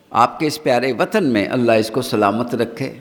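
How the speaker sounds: ordinary talk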